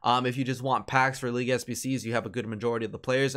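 ordinary delivery

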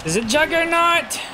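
startled, pitch jumping way up; suddenly panicked, asking who it is; alarmed high question. Real, not acted.

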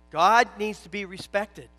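loud, slowing litany, each line hitting God; building preaching. Acted.

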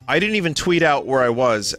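ordinary talk, nothing marked

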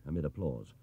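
plain reading voice

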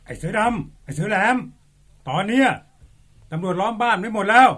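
police calling through a distant loudspeaker; megaphone call. Acted. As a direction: order them out over a megaphone.

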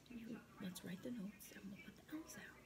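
whispering. Real, not acted. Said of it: "I have to whisper".

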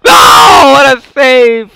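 high, loud, slow drawn-out yells; high, loud, stretched screams of disbelief; excited shouting. Real, not acted. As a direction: scream at the last-second save. Last-second shot saved.

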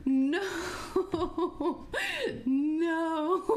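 half laughing, half crying in fear; scared refusal. Real, not acted. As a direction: wail no through nervous laughs.

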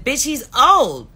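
high, loud furious scolding; yelling at a crying friend to chop onions; retold yelling. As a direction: tear into her, full volume. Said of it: "I said".

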